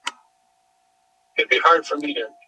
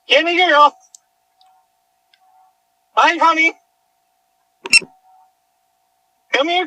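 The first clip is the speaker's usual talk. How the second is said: coaxing call to dog; 10 semitones higher than usual. Real, not acted. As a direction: lure the dog in with a bright, high call.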